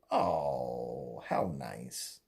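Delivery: very low, soft sarcastic aside; mock appreciation, dropped low. Real, not acted. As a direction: let it sink into a dry, unimpressed murmur. Sarcastic reaction to endorsements.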